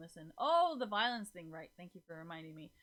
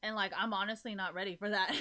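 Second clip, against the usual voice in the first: normal talking voice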